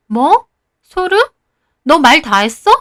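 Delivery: acted bristling, offended retort; indignant flare-up. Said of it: "효진이는 지가 더 발끈하더라고요". (발끈하며 따지는 연기)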